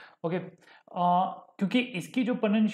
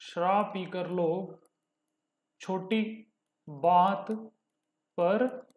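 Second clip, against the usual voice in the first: slow dictation; much slower than usual. Shown as a demonstration. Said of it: "teacher dictates example".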